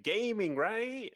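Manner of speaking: very high, excited gamer exclamation; Nick Terabay. Retold or imitated. Host mimics someone excited about gaming, high-pitched hype voice. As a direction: send the pitch soaring on a hyped catchphrase. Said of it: "I sound like Nick Terabay".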